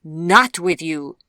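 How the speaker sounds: loud, slow, emphatic denial; vehement. A narrator's reading. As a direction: contradict forcefully, drawing it out. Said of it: "interjected the old woman vehemently".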